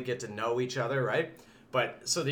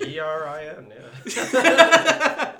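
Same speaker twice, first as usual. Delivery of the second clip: laughing; 10 dB louder, much slower than usual. Real, not acted. Spelling name, laughing.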